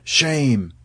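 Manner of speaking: understated shocked outcry; shocked cry. A narrator's reading. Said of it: "were shocked and cried out".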